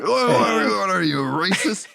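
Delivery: high, loud accusing stranger; hammed-up accusing bald guy; mock accusing shout. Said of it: "this bald guy's like".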